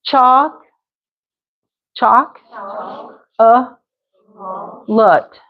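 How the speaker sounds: "Chalk", "A" as uh, "Lut", long pauses between; three split syllables. Shown as a demonstration. Teacher drills chocolate with the extra vowel, syllable by syllable. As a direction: sound out chocolate piece by piece. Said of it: "You could put another little".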